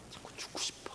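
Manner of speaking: whispered, very faint acted despair. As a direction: whisper it as a weary secret thought. Acting out a despairing symptom. (절망한 듯 속삭이는 흉내)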